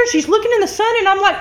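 ordinary delivery

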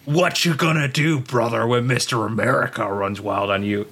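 hammed-up wrestler catchphrase voice; Hulk Hogan. Masked wrestler denies who he is, hammy impression. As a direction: play the wrestler striking a pose. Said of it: "Hulk Hogan's like".

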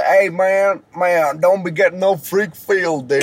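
lazy hammed-up Southern dude voice; mock Southern drawl. Joking about American dialects, a mock Southern dude. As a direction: slur it like a laid-back good ol' boy, half laughing. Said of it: "that's some Southern shit".

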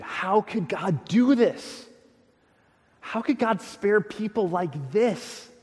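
slow, loud, outraged cry at God; unglued outrage. Retold or imitated. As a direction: let Jonah's outrage burst out, word by word. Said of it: "the guy comes absolutely unglued".

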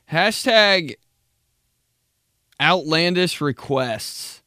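big, punchy show-segment callout; segment announcement. Shown as a demonstration. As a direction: announce the segment title boldly, with a pause inside. Announces segment title, then repeats it.

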